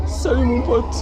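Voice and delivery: high, loud cartoon greeting; silly high voice. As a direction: squeak out a goofy hello. Greeting a character playfully. (petite voix aiguë et forte de dessin animé)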